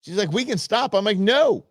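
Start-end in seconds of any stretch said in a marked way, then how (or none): 0.3-0.9 wife's offer. Retold or imitated. Wife offering to stop climbing. 1.2-1.7 a sharp, higher, louder no; stubborn no. Retold or imitated. Husband refusing his wife's offer to stop, stubborn no.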